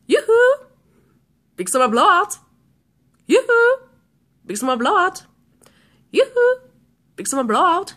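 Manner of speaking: annoying alarm voice. Retold or imitated. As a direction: mimic a chirpy salesman on repeat. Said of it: "the guy's voice is really, really annoying".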